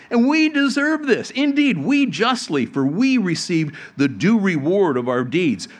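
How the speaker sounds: a thief rebuking his mocking partner; stern rebuke. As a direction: scold the other criminal, building to full voice. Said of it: "he rebuked him".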